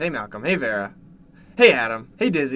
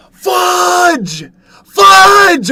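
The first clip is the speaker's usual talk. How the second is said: high, loud yelled curse; frustrated shout; 15 dB louder than usual. Acted. Streamer finds no cranberry sauce on his plate, shouting in high, loud dismay. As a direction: yell it like dinner's ruined.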